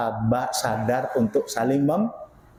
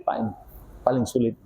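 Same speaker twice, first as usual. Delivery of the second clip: normal talking voice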